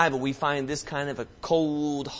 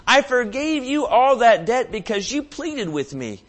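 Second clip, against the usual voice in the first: very slow, high, louder scolding of the slave; stern rebuke; 10 semitones higher, 7 dB louder, slower than usual. Retold or imitated. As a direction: rebuke the wicked slave slowly. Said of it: "his lord said to him".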